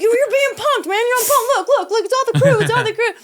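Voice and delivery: revealing the prank. Retold or imitated. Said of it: "he's like".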